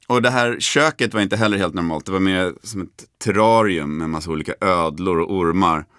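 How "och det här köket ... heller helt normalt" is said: usual speaking voice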